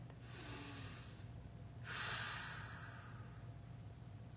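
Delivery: long audible sigh out; long exhale. Shown as a demonstration. Showing the group a deep breath, long audible exhale. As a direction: let the breath out slowly and fully. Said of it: "Deep breath".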